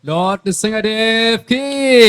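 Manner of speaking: loud, slow, high chant call; devotional call. Acted. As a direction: drag out the call, shouting to the crowd. Devotee leads a victory chant.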